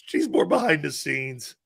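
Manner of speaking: normal talking voice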